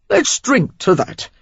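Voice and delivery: jolly Cockney rogue raising a glass; Cockney accent. A narrator's reading. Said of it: "Cockney in his accent".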